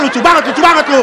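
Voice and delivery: loud mock angry retort. The angry driver answers the comic. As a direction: snap back sarcastically.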